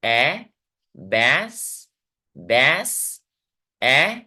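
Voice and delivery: ए as a held open "ae", as inside बैस; बैस and ए said singly, vowel stretched with rising tune; isolated vowel sound. Shown as a demonstration. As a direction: draw out बैस, then lift out its "ae" vowel. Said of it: "तो वहां पे ए sound है".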